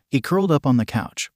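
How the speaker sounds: ordinary delivery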